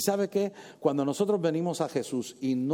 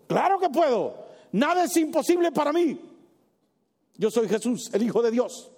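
proud declaration; 6 semitones higher than usual. Acted. Imagined Jesus declaring power.

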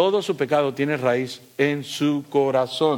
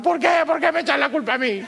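high, fast mock whine of the poor devil; hammed-up crybaby devil; whining devil; 10 semitones higher, faster than usual. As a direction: play the devil crying that he's blamed, laughing through it. Preacher voices the whining devil.

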